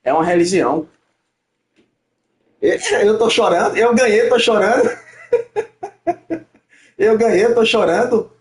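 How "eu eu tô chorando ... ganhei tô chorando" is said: higher, amused sarcastic protest; laughing mockery. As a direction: laugh off the idea of crying. Won, mocks accusation of crying, laughs. (deboche rindo, voz mais aguda)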